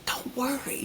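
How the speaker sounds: gentle and soft, like a nurse calming you; soothing nurse. Retold or imitated. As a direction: comfort a frightened patient. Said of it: "She went like that to me".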